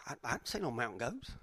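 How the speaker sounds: imitated low, very quiet mumble; oblivious reply. Hiker answering the excited group, low bewildered mumble. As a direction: drop it low and soft, as if clueless.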